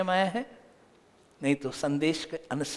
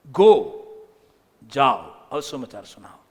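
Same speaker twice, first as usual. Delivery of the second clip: loud "go" then a lower commanding call; God's command; 5 semitones lower, 6 dB louder than usual. Retold or imitated. Preacher voices God commanding the church to go and preach, loud. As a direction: bark out the command to go. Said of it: "परमेश्वर ने कहा".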